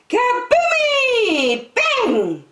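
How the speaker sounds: high, loud drawn-out kaboomie; bursting boom. A narrator's reading. Balloon bursting on the talent show stage, loud playful boom. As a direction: belt it out like a balloon popping.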